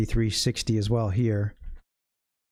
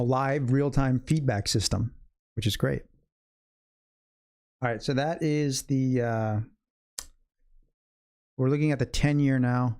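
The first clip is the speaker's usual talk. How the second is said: ordinary talk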